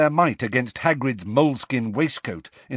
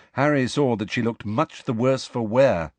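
ordinary delivery

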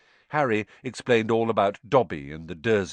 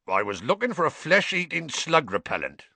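higher-pitched growly gamekeeper; growl; 7 semitones higher than usual. A narrator's reading. Hagrid explains his errand to Harry, growling. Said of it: "growled Hagrid".

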